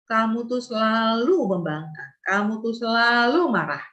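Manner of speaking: "selalu" drawn out with swooping emphasis; stress on SELALU. Shown as a demonstration. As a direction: stretch the word "selalu" as an accusation. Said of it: "kata selalu".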